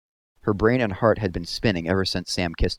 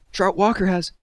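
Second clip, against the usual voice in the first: urgent; 11 semitones higher, 3 dB louder than usual. A narrator's reading. Catherine urgently reporting.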